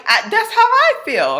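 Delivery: high, loud, worked-up; match his panic; exasperated agreement. Retold or imitated. Wife agrees with her husband, high and worked-up.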